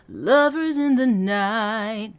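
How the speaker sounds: slow sung, anchored down on lovers; ground down on lovers. Shown as a demonstration. Coach showing anchor on lovers. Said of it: "really lean into that".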